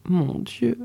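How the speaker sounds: a soft, hushed "Mon Dieu" under the breath; quiet despair. A narrator's reading. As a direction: murmur "Mon Dieu" to yourself, lost. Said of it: "fit à part soi la voyageuse".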